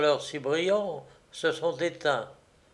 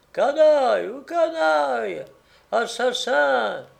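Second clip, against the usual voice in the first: high, drawn-out angry woman's cries; increasingly angry woman; 9 semitones higher, 4 dB louder, slower than usual. A narrator's reading. Woman in the house punctuating the gamekeeper's replies, angry. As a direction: hurl insults in a high woman's voice. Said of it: "la voix de femme de plus en plus en colère".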